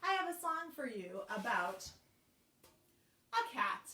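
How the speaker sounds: ordinary talk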